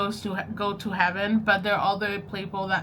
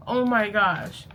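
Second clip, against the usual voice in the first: usual speaking voice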